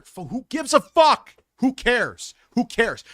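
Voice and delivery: high, loud outburst of disbelief; real exasperated yell; exasperated shouting. Real, not acted. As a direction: yell it like you are utterly fed up. Exasperated rant at movie complaint.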